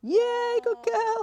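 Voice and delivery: high, bright praise for the dog; excited dog praise. Real, not acted.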